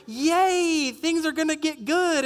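high, mock-gleeful yay; hammed-up innocent cheer; naive optimism. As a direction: celebrate like a hopeful little kid.

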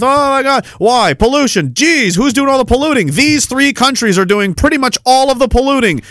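high mock-shocked voice, sarcastic. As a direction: ham up the alarm, sarcastic. Mocking alarmed dialogue.

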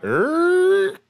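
high squeaky door creak; door squeak. Shown as a demonstration. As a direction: squeak like a rusty hinge. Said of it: "Three, two".

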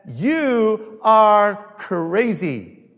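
very slow, loud "You are crazy"; king's drawn-out loud dismissal; shouted verdict. Retold or imitated. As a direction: drag each word out in scorn. King shouting at Paul.

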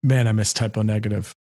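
low deep voice; dropping voice deep. Shown as a demonstration. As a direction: push the voice as low as it goes. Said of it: "that's as low as I could talk".

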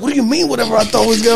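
playing the offended fiancee, fast and loud; indignant fiancee. Fiancee angry, retold quote.

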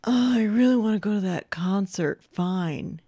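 put-on voice, grudgingly giving in; lightly acted reluctant surrender; mock reluctant. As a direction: imitate a kid caving reluctantly. Acting a kid's reluctant giving in.

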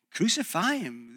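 ordinary talk

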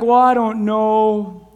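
slow, hesitant voice; unsure mumble. Retold or imitated. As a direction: say it slowly, like someone with no opinion. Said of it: "you say things like".

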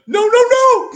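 very high, loud laughing no; panicked no. Retold or imitated. Quarterback reacting to his own interception, high laughing panic. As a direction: squeal it out as the pick flies. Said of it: "He's like".

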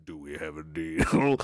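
low, slow devilish offer. Acted. Streamer playing the devil offering a deal, low and slow. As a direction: tempt them like the devil striking a bargain. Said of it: "A deal with the devil".

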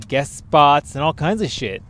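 ordinary talk

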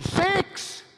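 high-pitched holler; hollered number. Retold or imitated. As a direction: holler it like a golfer's warning. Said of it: "He hollered".

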